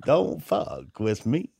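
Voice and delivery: slow, cool biker-uncle drawl; unhurried Southern-tinged impression; Uncle Tom. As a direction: draw it out like a tough old rebel. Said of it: "so he kinda talks real slow".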